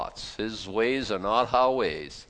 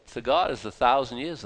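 usual speaking voice

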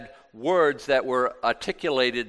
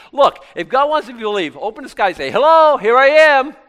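mocking skeptic; 11 semitones higher, 12 dB louder than usual. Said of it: "I have had people say to me".

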